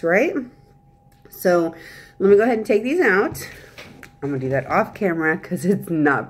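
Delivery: slight lisp on s sounds; speaking with aligners in. Real, not acted. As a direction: teeth covered by trays. Seen 0: She put old aligners back in and takes them out later.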